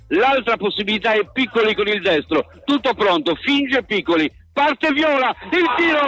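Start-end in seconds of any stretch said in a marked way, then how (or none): 4.5-6.0 rising fast, pitch climbing with the shot; building commentary excitement. Real, not acted. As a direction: build up as the ball is struck. Free kick about to be taken. (telecronaca in crescendo)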